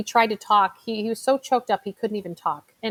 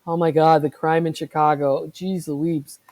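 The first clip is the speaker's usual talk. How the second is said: mock dismay; 5 semitones lower than usual. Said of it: "people will say".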